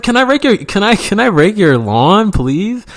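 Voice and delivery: high, pleading dorky voice; high, whiny self-parody asking to rake; mock nerdy kid. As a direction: overplay an awkward eager kid. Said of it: "I didn't say it like that".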